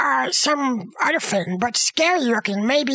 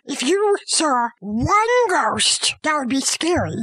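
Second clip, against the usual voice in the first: ordinary delivery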